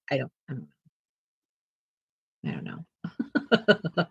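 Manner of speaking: slow, very quiet, trailing mumble; sheepish low mumble; mumbled reply. Retold or imitated. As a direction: mumble it like a clueless kid. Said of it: "I'm like".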